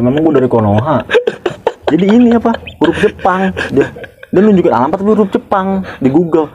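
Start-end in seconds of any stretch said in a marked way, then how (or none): 1.9-6.5 laughing disbelief. Real, not acted. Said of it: "laughing disbelief".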